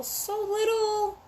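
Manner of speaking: high, slow and soft, cooing over something tiny; tender coo. Real, not acted.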